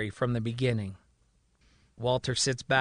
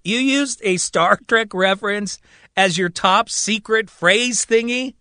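disbelief; 7 semitones higher, 7 dB louder than usual. A narrator's reading. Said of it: "Marion can't believe it".

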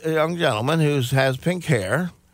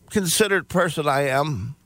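ordinary delivery

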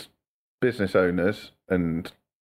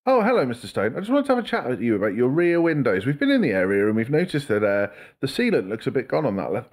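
put-on high sales voice; cold-caller patter; 6 semitones higher than usual. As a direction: imitate a window salesman launching into his patter. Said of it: "get into their patter as quickly".

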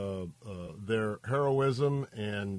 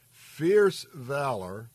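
"Fierce" hit louder and drawn out, slow title; stress on Fierce; 4 dB louder, much slower than usual. Real, not acted. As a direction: punch the word "Fierce", stretching it slowly. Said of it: "emphasis on fierce".

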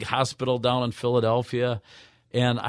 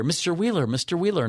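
calling out; 7 semitones higher than usual. Retold or imitated. Doctor calls him in hallway.

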